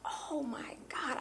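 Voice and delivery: slow, hushed disbelief; stunned, barely voiced gasp of words; awed whisper. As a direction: whisper it, stretched out in astonishment. Stunned at the purse's price.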